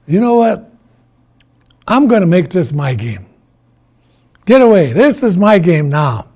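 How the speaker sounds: loud, high, pushy claim; forceful, greedy declaration; bossy. Acted. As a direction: order everyone off, taking over. Iktomi takes over the game.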